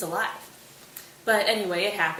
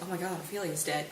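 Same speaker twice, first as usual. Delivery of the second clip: flat, softer, lower; deadpan echo; 3 semitones lower, 7 dB quieter than usual. Retold or imitated. Hamlet echoing Laertes' news, low, soft and deadpan. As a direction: undercut the drama with a dull mumble.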